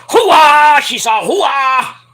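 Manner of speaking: loud gung-ho soldier; Al Pacino hoo-ah. Retold or imitated. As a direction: bark hoo-ah like Scent of a Woman. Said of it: "Al Pacino".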